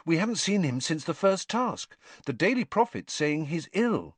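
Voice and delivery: lightly voiced teenage boy; a hint of young Harry. A narrator's reading. Harry explaining to Winky.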